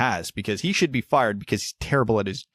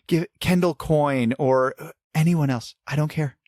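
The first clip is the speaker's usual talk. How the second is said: normal talking voice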